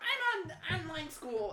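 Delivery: high, slow put-on voice; mocking imitation. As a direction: mimic an acquaintance showing off, drawn out. Imitating the acquaintance's boast.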